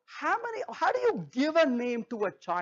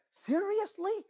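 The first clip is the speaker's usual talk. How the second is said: incredulous; 9 semitones higher, 4 dB quieter, slower than usual. Retold or imitated. Incredulous reaction in retold talk.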